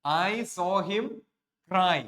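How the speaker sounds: extra slow emphasis. Shown as a demonstration. Teacher repeating the example before explaining it, drawn out. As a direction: linger on each word so the class hears it. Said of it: "എന്താ അതിന്റെ അർത്ഥം?".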